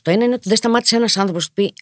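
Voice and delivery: ordinary delivery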